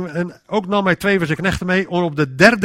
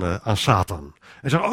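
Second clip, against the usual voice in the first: usual speaking voice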